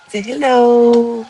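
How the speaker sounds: high, loud, slow coax to the dog; pet coaxing. Real, not acted. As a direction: draw the dog out with a sing-song call.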